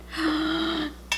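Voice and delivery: sharp delighted gasp; quick thrilled intake of breath; excited gasp. Real, not acted.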